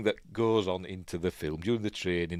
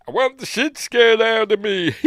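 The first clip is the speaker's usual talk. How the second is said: high, loud, gleeful demand; thrill-seeking shout; 13 semitones higher, 8 dB louder than usual. Retold or imitated. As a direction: yell it like a daredevil begging for fear. Birthday man's wild demand.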